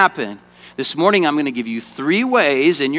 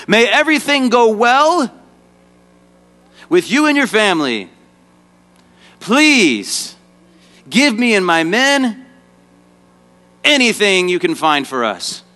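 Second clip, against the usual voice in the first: high royal address, phrase by phrase; a king's formal plea, starting high and settling; kingly proclamation; 6 semitones higher than usual. Acted. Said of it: "like a king would say 'em".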